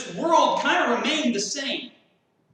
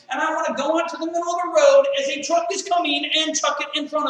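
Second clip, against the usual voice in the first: louder and higher, pushing fast through the rant; fired-up preaching; 5 semitones higher, 6 dB louder than usual. Real, not acted. Preacher to congregation about hating the news, loud heated rant. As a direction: vent it like you're sick of the news.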